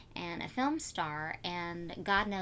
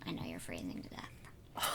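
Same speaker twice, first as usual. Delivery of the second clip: unvoiced whisper, much softer and lower; whispered aside; 9 dB quieter than usual. Real, not acted. Host whispers an aside about a fan's cold hometown.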